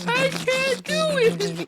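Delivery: very high, drawn-out squeal; mock despair. Failed grass player giving up, mock high wail. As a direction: give up in a slow falsetto wail. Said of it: "You're like".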